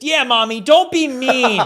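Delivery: high, loud child voice imitation; mock little-kid telling-off; little daughter. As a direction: pipe up like a scolding kid, stretching the words. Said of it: "my, my oldest".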